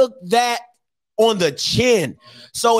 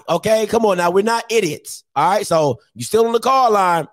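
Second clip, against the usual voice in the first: normal talking voice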